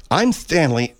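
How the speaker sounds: normal talking voice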